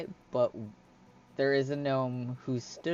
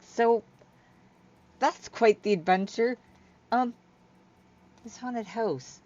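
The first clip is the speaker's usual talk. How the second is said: high, halting character voice; hesitant Hyla; 9 semitones higher than usual. Acted. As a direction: probe carefully in a raised voice, with pauses. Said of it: "She's like".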